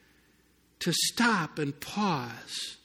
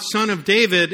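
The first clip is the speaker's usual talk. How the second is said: louder retold call from the roadside; 4 semitones higher, 8 dB louder than usual. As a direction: call out over the crowd, raised and loud. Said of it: "He would call out".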